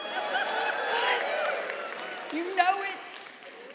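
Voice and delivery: high, slow whooping hype; excited shout. Acted. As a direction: whoop it up for donuts. Hyping love of Krispy Kreme.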